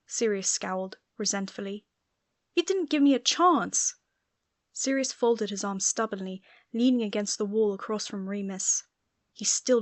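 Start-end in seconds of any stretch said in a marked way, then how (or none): 2.5-3.9 much higher, louder resentful cry; resentful outburst. Acted. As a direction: burst out feeling wronged. Quarrel between Sirius and Remus.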